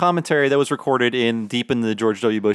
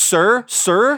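higher, pleading sir; mock polite; 10 semitones higher than usual. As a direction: fake courteous interrupt. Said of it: "You have to go".

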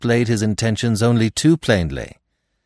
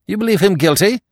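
high, loud, sharp challenge; sharp question; 13 semitones higher, 7 dB louder, faster than usual. A narrator's reading. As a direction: demand it sharply, pitched right up. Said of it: "said Stoner sharply".

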